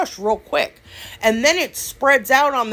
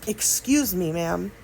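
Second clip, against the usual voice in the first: softer, gentle "Excuse me, ma'am"; soft polite excuse me; 5 semitones lower, 7 dB quieter, slower than usual. Shown as a demonstration. Showing viewers how to politely ask to get by, softly. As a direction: say it sweetly, like good manners. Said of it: "I always say".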